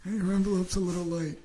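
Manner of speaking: light mock gangster voice; hint of a mob enforcer; mob guy voice. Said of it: "You feel like a mob guy".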